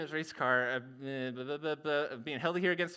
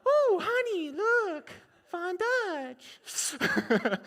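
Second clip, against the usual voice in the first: high, louder Midwest squeal; excited Midwest wife; 12 semitones higher, 3 dB louder, much slower than usual. Acted. Wife thrilled by the paint style, high Midwest squeal. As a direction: coo at the pinstripes. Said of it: "has the most Midwest accent".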